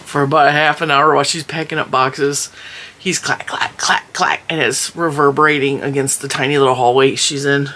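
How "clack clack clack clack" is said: whispered clacking. Retold or imitated.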